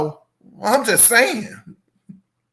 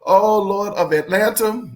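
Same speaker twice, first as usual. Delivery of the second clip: ordinary talk, nothing marked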